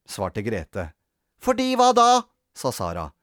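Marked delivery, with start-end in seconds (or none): 1.4-2.1 put-on high, loud childish comeback; pestering retort; challenging. A narrator's reading. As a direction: demand a reason like a cross child. Sara challenges Grete.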